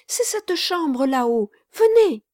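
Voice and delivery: high, rising to a loud call on Venez; beckoning him upstairs urgently; eager urging girl. Acted. Marquise urging.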